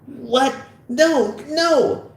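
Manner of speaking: high-pitched alarmed pleading; frantic high cries; panicked protest. Acted. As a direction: protest as you're being cuffed. Fogel being handcuffed.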